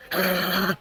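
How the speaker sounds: sharp high gasp of shock; acted gasp. Caught at gunpoint in the woods, high shocked gasp. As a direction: gasp like you just got caught. Caught by police, story climax.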